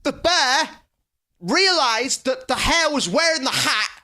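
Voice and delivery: loud, high, exasperated shouting; mock angry rant. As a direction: rant it out like a fed-up storyteller. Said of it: "I don't know why you're getting angry".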